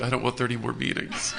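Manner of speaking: laughing through the punchline; can't keep a straight face on it. Real, not acted. Telling the group her punchline, laughing.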